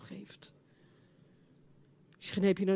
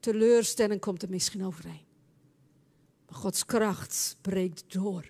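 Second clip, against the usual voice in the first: ordinary delivery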